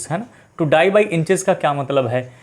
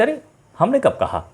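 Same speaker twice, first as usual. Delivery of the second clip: a higher, mock-innocent denial; backing-out denial; 7 semitones higher than usual. Teacher mimics someone going back on a promise, mock innocent. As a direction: play the promise-breaker feigning surprise. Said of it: "और आज कहते".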